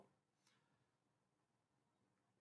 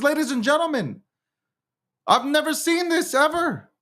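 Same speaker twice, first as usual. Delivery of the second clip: very high-pitched, exasperated outcry; incredulous rant. Real, not acted. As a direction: climb into a high, strained voice of disbelief. Incredulous rant about gangs taking over.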